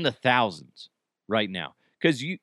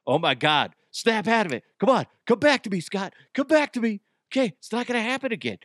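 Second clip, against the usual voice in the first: mock pleading; 9 semitones higher, faster than usual. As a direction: call him back from a trance, hammed-up. Mock snapping cohost out of trance.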